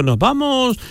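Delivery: high, light mock voice of a carefree caller; imitating the caller. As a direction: tease him by playing him announcing trips. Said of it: "Yo no te escucho".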